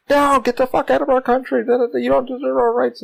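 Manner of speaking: high, shouty protester jeer, mocked; mock angry protester. Protesters yelling at immigrants to leave, mock angry jeer. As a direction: sneer it like a hostile crowd. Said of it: "Americans are outside going".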